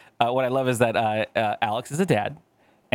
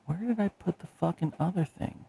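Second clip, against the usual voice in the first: muttering while searching; 6 semitones higher, 8 dB quieter than usual. Real, not acted. Searching for files.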